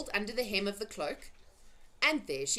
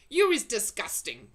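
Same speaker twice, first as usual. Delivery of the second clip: disgusted BFG; 3 semitones higher, 8 dB louder than usual. A narrator's reading. BFG condemning.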